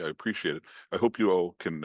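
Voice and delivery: ordinary delivery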